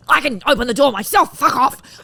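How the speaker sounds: loud, high mock snap at a man; mock snappy retort. To men holding doors, a loud high mock snap. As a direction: bark it like a tough woman brushing off help. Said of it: "instead of me going".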